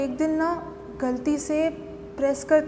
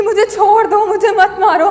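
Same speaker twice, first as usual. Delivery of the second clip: loud, higher desperate begging; screamed pleading; 4 semitones higher, 9 dB louder than usual. Acted. Wife begging husband to stop beating her, crying out. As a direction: beg for mercy at full cry. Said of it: "बहुत चिल्लाती थी sir".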